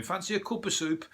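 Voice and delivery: high Brummie voice, lilting up at the end; offering soup in a broad Brummie accent. Retold or imitated. Comedian on a camping trip offers soup in a Brummie accent. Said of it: "He was putting on their Brummie accents".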